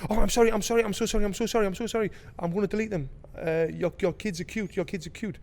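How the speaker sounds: flustered apology. Retold or imitated. Caught stranger apologising to the angry dad, lightly flustered.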